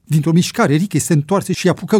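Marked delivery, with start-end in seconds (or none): none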